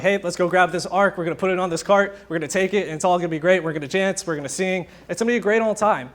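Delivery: breezy, over-keen rallying of friends; carefree enthusiasm. Retold or imitated. Preacher voices David rallying people to move the ark, carefree and enthusiastic.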